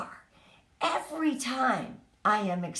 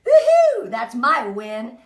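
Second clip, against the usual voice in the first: mock victory cheer; 3 semitones lower, 8 dB louder, slower than usual. Cheering to her team about nine no's, gleeful and loud. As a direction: celebrate the no's like a win. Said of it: "I'd be like".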